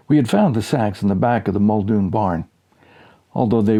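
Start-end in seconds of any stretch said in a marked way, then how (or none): none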